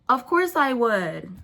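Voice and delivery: slow, sugary fake yes; fake answer. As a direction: drag it out insincerely. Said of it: "Long and fake answer".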